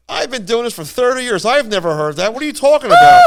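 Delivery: rising to a loud, high outburst at the end; mock-exasperated old expert; indignant guide. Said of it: "and he goes".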